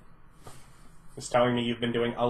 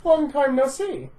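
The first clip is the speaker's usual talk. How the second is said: villain voice; 10 semitones higher than usual. Acted. GM voices villain greeting player.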